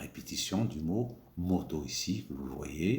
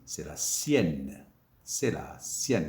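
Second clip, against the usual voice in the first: slow model phrase; 4 semitones lower, slower than usual. Shown as a demonstration. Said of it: "on dit".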